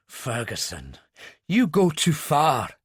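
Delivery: a touch of suppressed rage at Ferguson; seething. Acted. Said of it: "Carter was seething".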